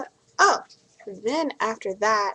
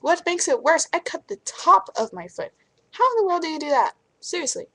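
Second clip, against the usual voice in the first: incredulous stranger; 8 semitones higher, 3 dB louder than usual. Retold or imitated. Strangers marveling at the cut.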